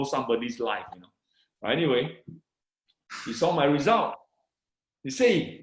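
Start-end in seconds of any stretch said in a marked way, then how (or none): none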